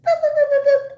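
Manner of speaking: very high, loud beeping boops. Pretend. High boop sounds.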